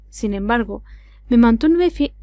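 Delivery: plain reading voice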